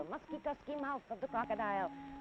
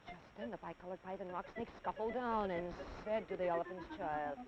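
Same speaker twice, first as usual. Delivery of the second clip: usual speaking voice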